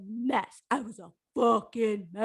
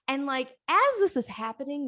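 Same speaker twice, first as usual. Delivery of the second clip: usual speaking voice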